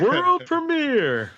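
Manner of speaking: high, slow announcer voice; hype announcer. Acted. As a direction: stretch it out like an announcer. Echoing premiere in hype voice.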